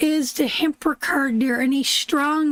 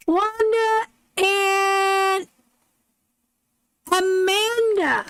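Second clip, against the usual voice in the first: announcing winners; 6 semitones higher, slower than usual. Real, not acted. Announcing giveaway winners' names.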